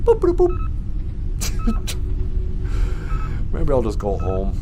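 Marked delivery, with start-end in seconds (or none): 0.0-0.6 answering as the spaceship computer; robot computer beeps. Acted. 0.6-3.5 very high, breathy computer laugh; robot giggle. Acted. Computer snickers at the stranded pilot in a high breathy giggle.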